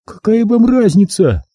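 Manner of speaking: high, testy comeback; high-pitched irritation; exasperated captive woman. A narrator's reading. Captive woman rebuffs question.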